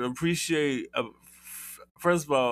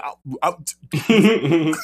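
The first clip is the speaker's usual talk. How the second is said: imitated stammer; 4 semitones higher, 9 dB louder, much slower than usual. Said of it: "he was just like".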